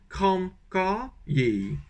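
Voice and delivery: kram ka ye slowly, southern ye at the end; southern Vietnamese accent, slow careful demo. Shown as a demonstration. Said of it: "avec l'accent du sud".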